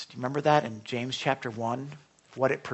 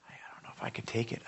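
whispered fear; 4 semitones lower, 10 dB quieter, faster than usual. Retold or imitated. Retold fear on the way down.

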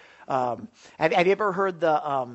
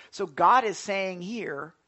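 ordinary talk

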